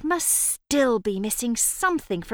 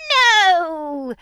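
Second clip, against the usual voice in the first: witch voice; 7 semitones higher, 8 dB louder than usual. Acted. Witch rejects the result.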